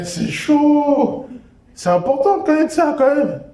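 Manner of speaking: hammed-up teacher agreeing it matters; maths teacher.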